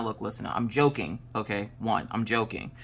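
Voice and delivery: ordinary talk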